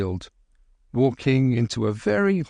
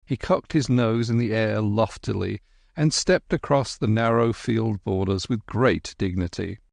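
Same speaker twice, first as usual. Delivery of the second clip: usual speaking voice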